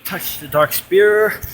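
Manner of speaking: ordinary talk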